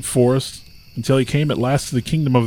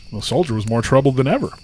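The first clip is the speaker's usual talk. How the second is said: ordinary delivery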